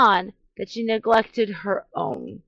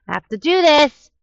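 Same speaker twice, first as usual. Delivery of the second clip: determined outburst; 8 semitones higher, 7 dB louder than usual. Retold or imitated. Frustrated wife takes over.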